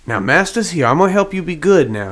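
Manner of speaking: master calming an excited pup; lifted, singsong reassurance to a pet; reassuring master. Acted. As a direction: settle the pup down, pitch swooping up. Master soothing his excited pup.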